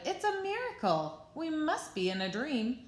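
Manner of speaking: high, excited cheering; amazed shout. A narrator's reading. Animals watching Gerald dance, high amazed shout. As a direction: marvel out loud as a crowd. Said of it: "They shouted".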